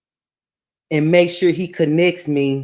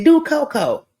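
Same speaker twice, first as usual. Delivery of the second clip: called-out quote again; 10 semitones higher than usual. Retold or imitated. Reminding the friend of the party call, quicker and lighter. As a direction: toss off Do Coco like a laughing reminder. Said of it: "Remember somebody said".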